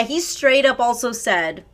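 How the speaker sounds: ordinary talk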